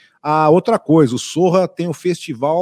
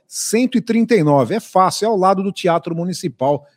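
ordinary talk, nothing marked

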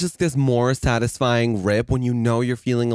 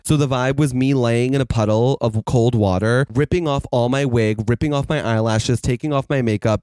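ordinary talk, nothing marked

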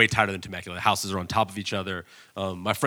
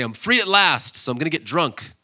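high, gleeful, a little louder; a hint of a careless drinker celebrating; freed alcoholic joking he'll get drunk; mock freed addict; 7 semitones higher, 7 dB louder than usual. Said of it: "He's not going to say".

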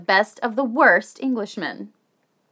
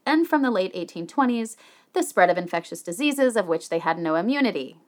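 usual speaking voice

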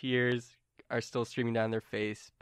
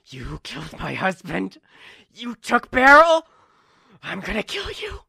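high, loud enraged outburst; furious accuser; 8 semitones higher, 11 dB louder than usual. Acted. Attacker grabbing you over a killed husband, furious shout. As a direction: shout it in anguished fury, voice pitched way up.

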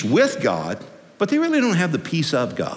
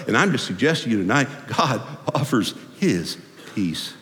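ordinary delivery

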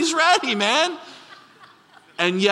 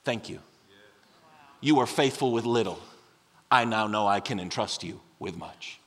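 much lower and softer, tender gratitude; Jesus, tender; 11 semitones lower, 6 dB quieter than usual. Retold or imitated. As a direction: speak gently to the little boy. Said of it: "and said".

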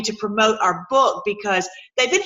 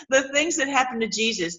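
normal talking voice

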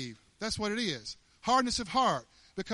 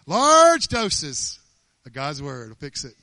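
loud preaching; 3 semitones lower, 13 dB louder, slower than usual. Shown as a demonstration. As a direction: hit "Large doses" as a loud sermon cry.

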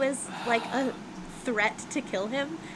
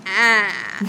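real high-pitched shriek; 2 semitones lower, 9 dB louder than usual. Real, not acted. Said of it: "high-pitched".

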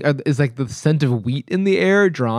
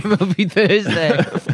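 slow laughing repeat; 3 semitones higher, much slower than usual. Real, not acted. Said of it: "repeats with a laugh".

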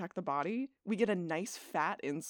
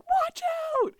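shrieking high and loud warning; panicked scream; 6 dB louder than usual. Retold or imitated. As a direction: yell it in panic, pitch way up. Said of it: "Brooke is all like".

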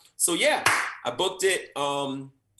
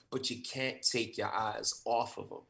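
ordinary delivery

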